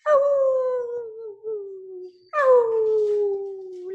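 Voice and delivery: high, loud howl; dog howl. Acted. Lucy still howling after the meal, loud high howl. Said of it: "food didn't stop her for howling".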